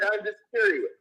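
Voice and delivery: ordinary talk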